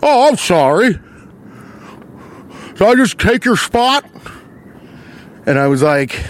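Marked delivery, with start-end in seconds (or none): 0.0-4.0 high, mocking older man's voice; hammed-up impression of an apologetic old-timer; Jimmy Stewart. Line-cutter to the man behind him, Jimmy Stewart impression. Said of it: "I'm doing a Jimmy Stewart".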